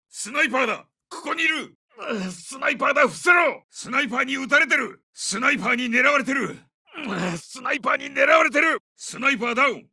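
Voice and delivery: high, strained battlefield shout; alarmed shout. Acted. Soldier warns squad of sniper fire, alarmed high shout. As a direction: yell a warning under fire.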